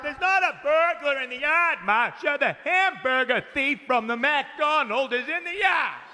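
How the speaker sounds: high, slow exasperated father; exasperated dad. Retold or imitated. Dad correcting Masha, high and slow. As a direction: correct her like an irritated dad.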